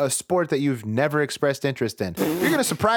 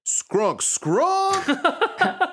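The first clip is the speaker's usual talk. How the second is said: creepy scrunk sound. Acted. Narrator voices creepy sound.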